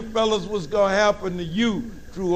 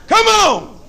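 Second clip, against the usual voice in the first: mock daddy bravado; 7 dB louder than usual. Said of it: "You think".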